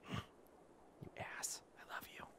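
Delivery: whispered, affectionate aside; fond whisper. Real, not acted. Player whispering to the one-armed Varl, fond. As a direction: breathe it softly, teasing then tender.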